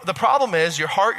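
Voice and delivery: ordinary talk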